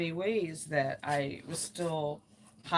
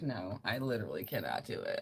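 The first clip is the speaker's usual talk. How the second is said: retold refusal; 8 semitones lower, 5 dB quieter than usual. Imagined friend refusing, lowered voice.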